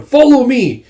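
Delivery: loud, high battle cry to the troops; rallying shout. Acted. Judo shouting to his troops to follow, loud and high. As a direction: call the soldiers forward at full voice.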